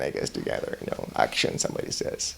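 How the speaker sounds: breathy whisper; whispered aside about the cameras rolling. Acted. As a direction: let it drop to a whisper.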